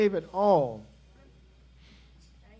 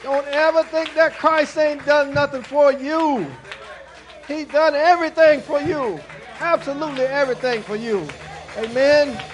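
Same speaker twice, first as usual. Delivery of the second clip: louder, higher climactic preaching; full-force preaching; 6 semitones higher, 11 dB louder than usual. Acted. Pastor insisting to the congregation that Christ did everything, preached loud. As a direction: hammer the point home to everyone.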